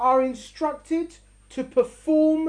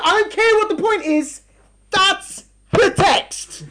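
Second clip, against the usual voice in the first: stubborn conductor; 5 semitones higher, 5 dB louder, slower than usual. Retold or imitated. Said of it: "He's like".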